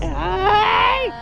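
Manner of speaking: long drawn-out ghostly yell; ghost scare shout. Acted. Ghost leaps at the rider with a wailing yell. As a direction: stretch the hey into a wailing scream.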